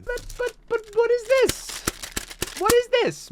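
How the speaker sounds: high, squeaky mock-shocked cry; shocked squeal. Vlogger opening on camera with a high squeal of shock.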